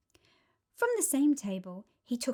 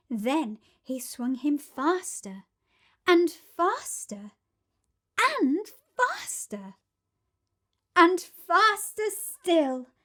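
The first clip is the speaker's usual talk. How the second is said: building excitement; 6 semitones higher, 6 dB louder, slower than usual. A narrator's reading. Narration building speed.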